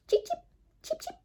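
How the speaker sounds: very high tiny cheeps; baby chick peeps. Acted. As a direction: peep like newborn chicks. Chicks peeping.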